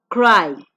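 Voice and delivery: "Cry." said loud and clear for the class to repeat; teacher modelling "Cry." loudly; model word loudly. Shown as a demonstration. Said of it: "repeat, please".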